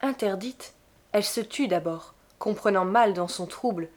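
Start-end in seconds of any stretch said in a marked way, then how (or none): none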